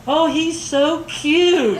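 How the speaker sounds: high, slow, drawn-out coo; mock gushing. Hardware store worker about the speaker's dad, mock gushing. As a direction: imitate someone fawning, drawing out the words.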